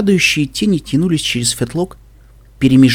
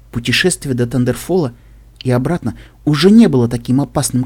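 usual speaking voice

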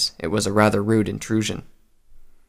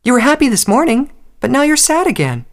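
very high, louder little filly voice; insistent child; 5 dB louder than usual. A narrator's reading. Filly confronts her mother's sadness, childishly persistent. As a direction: press the point like an upset small child. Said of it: "the filly persisted".

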